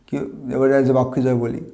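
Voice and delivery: ordinary talk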